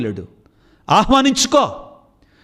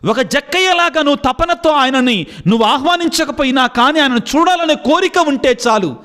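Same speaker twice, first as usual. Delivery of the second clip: raised, higher preaching voice building to a climax; preaching at full force; 7 semitones higher than usual. Acted. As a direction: preach it out to the believers, fervent. Preacher exhorting the congregation. (ఉత్సాహంగా బోధించే స్వరం)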